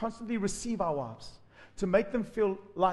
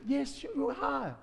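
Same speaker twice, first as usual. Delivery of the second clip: sweet greeting; 6 semitones higher, much slower than usual. Shown as a demonstration. Husband greeting his wife sweetly instead of snapping. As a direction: greet her gently and gladly. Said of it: "if you spoke with her".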